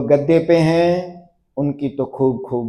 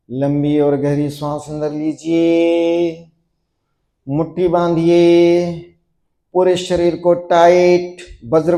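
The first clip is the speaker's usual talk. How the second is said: steady chant-like commands building louder to the release; building yoga drill; 2 semitones higher, 4 dB louder than usual. Acted. Yoga teacher building students' tension before the drop, mounting drill cueing. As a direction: drive the tension up, then call the drop.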